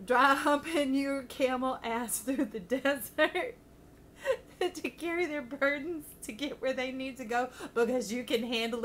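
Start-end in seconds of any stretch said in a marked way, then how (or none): 0.1-6.1 words broken by giggles; can't hold the laugh in; laughing through words. Real, not acted. Cracking up at her own phrase.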